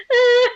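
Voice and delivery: high, loud whimpering cry; hammed-up squeal of despair; mock wail. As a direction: wail helplessly like a cartoon girl. Heroine sitting helpless on floor.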